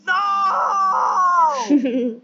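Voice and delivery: long, loud drawn-out no; angry. Real, not acted. Player losing at a hard game, angry yell. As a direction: yell it high and stretch it out. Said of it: "He's angry".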